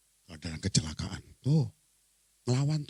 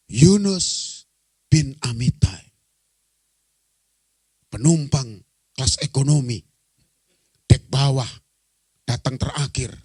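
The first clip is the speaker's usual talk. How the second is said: loud, halting roll-call announcement; reading out a name; 3 semitones higher, 7 dB louder than usual. Acted. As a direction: read it out in separate, weighty chunks. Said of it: "Waktu dibaca lengkap". (membacakan nama penumpang dengan lantang, terputus-putus)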